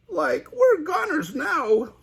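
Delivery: high slow doomed Shaggy; high frightened Shaggy; Shaggy gulping. Acted. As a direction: gulp in fear. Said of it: "gulped Shaggy".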